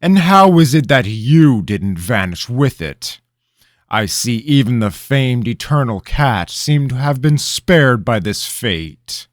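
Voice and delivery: loud, high suspicious questioning, slowing at the end; king accusing the boy, suspicious. Acted. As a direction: needle him with suspicion.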